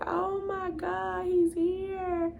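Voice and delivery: high, drawn-out retold tearful joy; imitating being overcome with happiness; overjoyed awe. Retells tearful joy at first cry.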